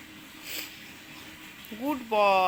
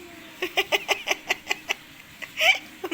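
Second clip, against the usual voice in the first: high delighted laugh; 9 semitones higher than usual. Real, not acted. Mother delighted by son's answer.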